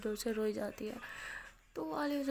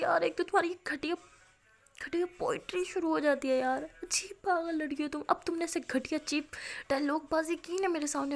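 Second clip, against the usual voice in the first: mock scolding; 5 semitones higher, 5 dB louder than usual. Maya scolds Aaliya's cheesy lines.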